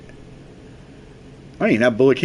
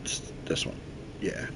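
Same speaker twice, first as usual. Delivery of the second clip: whisper; 15 dB quieter than usual. Real, not acted. Whispered aside.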